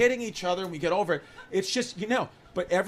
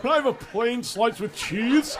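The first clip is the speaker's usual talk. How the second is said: a touch higher, prissy order; mocking customer voice; 4 semitones higher than usual. Comic recalling the pizzeria customer, prissy voice. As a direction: mimic the fussy customer again.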